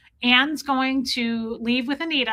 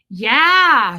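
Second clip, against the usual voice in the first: emphatic yeah; 9 dB louder than usual. Retold or imitated. Mar Lugo's emphatic yeah.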